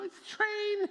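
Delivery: very high, loud mock-exasperated it's a train; exasperated squeal. Pastor mocking a Facebook commenter, squealing in disbelief.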